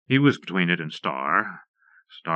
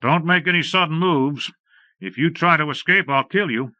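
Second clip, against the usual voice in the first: higher, firm warning voice; loud warning; 7 semitones higher, 3 dB louder than usual. A narrator's reading. Ranger warning the outlaw not to escape, raised and firm. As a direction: warn him plainly so he hears. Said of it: "he spoke loud enough for the outlaw to hear".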